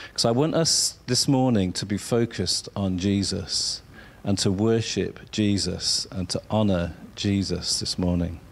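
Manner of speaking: ordinary talk, nothing marked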